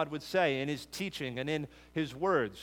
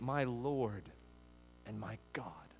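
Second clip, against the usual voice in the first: softly, the second half much softer; hushed confession; 4 semitones lower, 9 dB quieter, slower than usual. Retold or imitated. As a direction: murmur it like a quiet awed confession. Quoting Thomas's confession.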